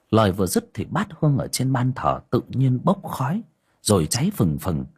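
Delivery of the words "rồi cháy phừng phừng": ordinary delivery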